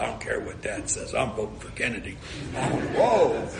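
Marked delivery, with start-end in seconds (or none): 0.0-2.2 hushed secret. Retold or imitated. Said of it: "She said".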